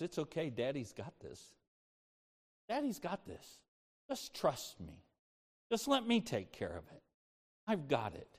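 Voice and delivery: very soft, hushed reassurance; calm a fearful child, almost under the breath; quiet, soothing voice of a loving father; tender fatherly comfort. Retold or imitated. God comforting as a father, retold.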